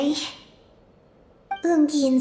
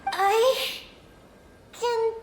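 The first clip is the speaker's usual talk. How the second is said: straining effort; 9 semitones higher, much slower than usual. Acted. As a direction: voice climbing higher and higher with strain. Straining as swallowed by creature.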